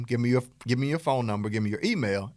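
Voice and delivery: usual speaking voice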